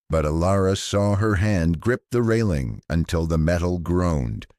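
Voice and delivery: usual speaking voice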